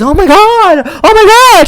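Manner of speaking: very high, hammed-up squeal of recognition; mock squealing excitement. Bar stranger hearing someone is from Wisconsin, mock squealing excitement. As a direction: imitate an overexcited fan, voice shooting way up.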